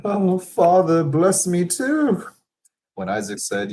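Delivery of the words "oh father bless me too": high, slow, keening plea; pleading sob. Acted. Esau begging his father for a blessing, sobbing. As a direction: beg through bitter sobbing. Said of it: "burst into loud, bitter sobbing".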